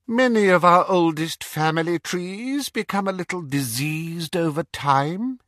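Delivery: high, cold, mocking villain voice; cold dark lord. Dark lord mocking the Death Eaters, cold and sneering. Voldemort lecturing.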